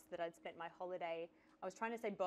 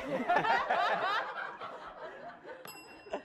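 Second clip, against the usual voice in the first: burst of laughter; 12 semitones higher, 7 dB louder than usual. Real, not acted. Laughing at yodel joke.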